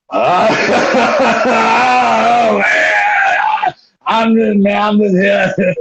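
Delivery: put-on woozy drunk with no idea what's going on; sloshed Drake. Sloshed Drake answers Billy's accusation, drunkenly. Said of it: "Drake's sloshed at this point".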